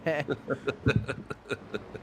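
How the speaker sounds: high burst of laughter; amused, high-pitched laugh. Real, not acted. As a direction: laugh it out after a big race. Reaction after the race.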